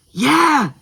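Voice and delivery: high, loud cheer of yes; eager kid's high yell; excited agreement. A narrator's reading. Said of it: "Taika agreed excitedly".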